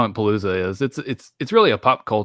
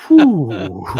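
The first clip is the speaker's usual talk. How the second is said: louder puffed exhale; mock tired exhale; 8 dB louder than usual. Cohost mocking the lazy kung fu with a puffed exhale. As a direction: huff like it's exhausting. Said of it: "She was like".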